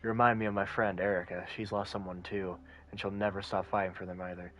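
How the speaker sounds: low, soft, calm detective. Acted. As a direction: speak low and quiet like a detective. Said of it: "is my detective voice good".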